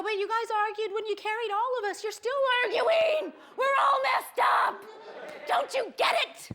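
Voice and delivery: high protest building to a shout; escalating exasperated argument. Retold or imitated. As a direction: argue back, rising into a loud high yell. Acted argument with parents, escalating.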